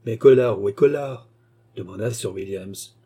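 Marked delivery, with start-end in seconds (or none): 0.1-1.3 insistent master questioning his lieutenant; impatient demand. A narrator's reading. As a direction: press for an answer. Master presses his lieutenant for news.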